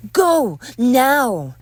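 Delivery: loud, high shouted order; forceful yell to leave; angry command. Acted. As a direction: bark it at full voice. Ordering intruder away.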